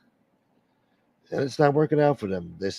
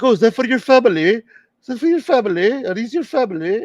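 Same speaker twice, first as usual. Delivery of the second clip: high, loud put-on European accent; 12 semitones higher, 5 dB louder than usual. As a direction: ham up a European tourist's accent, much higher and louder. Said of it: "do a European accent".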